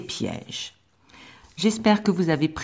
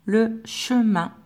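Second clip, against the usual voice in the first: Le chemin said slowly, syllables spaced; slow dictation; 5 semitones higher, slower than usual. Shown as a demonstration. Teacher repeating the dictation word, slow. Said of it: "je vais trop vite".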